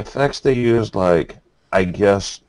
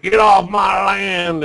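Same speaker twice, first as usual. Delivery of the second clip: slow, much higher shouted threat, gun raised; angry hillbilly; 10 semitones higher, slower than usual. Retold or imitated. Mountain man threatening the film crew, mock shout. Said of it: "pointing his gun in their face".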